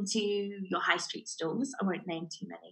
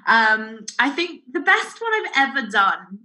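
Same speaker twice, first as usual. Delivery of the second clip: ordinary delivery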